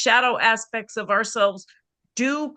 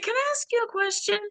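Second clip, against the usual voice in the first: much higher, fast sugary ask; sweet high question; 12 semitones higher, 4 dB quieter, much faster than usual. Retold or imitated. Coach asking her client, sweet high question. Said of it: "I said".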